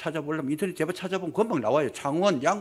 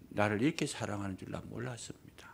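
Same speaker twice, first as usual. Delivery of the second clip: whispered repentant words; elder whispering his repentance to the pastor; hushed confession; 4 semitones lower, 8 dB quieter than usual. Acted.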